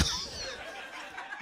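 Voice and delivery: airy high-pitched laugh; high breathy laugh. Real, not acted. Comic laughing at his own dog joke, high airy cackle. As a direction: let out a squeaky airless laugh.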